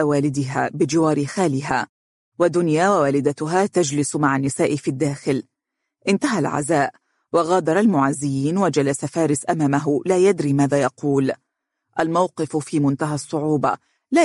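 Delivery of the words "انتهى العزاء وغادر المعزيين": ordinary delivery, nothing marked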